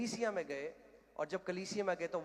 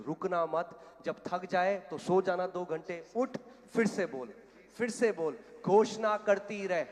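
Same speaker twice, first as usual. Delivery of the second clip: forceful urging; 3 semitones higher, 11 dB louder, slower than usual. Retold or imitated. Evangelist urging the wife to keep declaring, forceful preaching shout. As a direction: drive her on, stretching each command.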